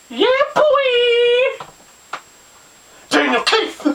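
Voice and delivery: high-pitched, drawn-out hype shout; playful cheer. Pretend. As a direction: whoop your own name like a fan. Cheering for his own namesake.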